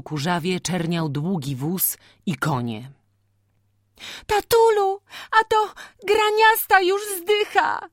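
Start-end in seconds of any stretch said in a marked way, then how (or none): 4.3-7.9 put-on much higher, louder girl's cry; high, loud girl's alarmed call. A narrator's reading. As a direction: call to father in alarm as a young girl. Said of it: "wołała".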